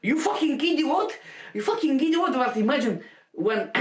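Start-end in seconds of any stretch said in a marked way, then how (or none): 0.0-2.3 higher-pitched imitation of an enraged shout; imitated furious insult, raised in pitch; mock angry shout. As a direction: act out his raging outburst, without full volume. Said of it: "he started to shout".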